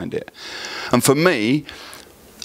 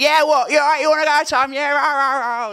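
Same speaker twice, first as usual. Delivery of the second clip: high, mouthy Essex lad; essex accent. Retold or imitated.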